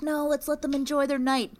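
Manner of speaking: high-pitched airy impression; mimicking a friend. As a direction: imitate a carefree friend in a high voice. Mimics friend's naive kind refusal.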